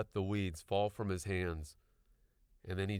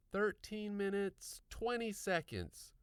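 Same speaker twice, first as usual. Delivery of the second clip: put-on girl's voice; 13 semitones higher, slower than usual. A narrator's reading. Girl reports time.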